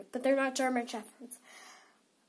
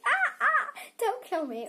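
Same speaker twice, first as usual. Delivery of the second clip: imitating sister's scream; 12 semitones higher, 4 dB louder than usual. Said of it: "And she says".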